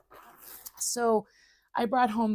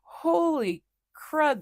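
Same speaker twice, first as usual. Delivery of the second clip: surprised; 9 semitones higher, 6 dB louder, much slower than usual. Retold or imitated. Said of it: "I'm like".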